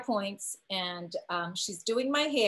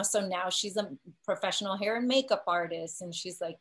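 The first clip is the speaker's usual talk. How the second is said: ordinary talk, nothing marked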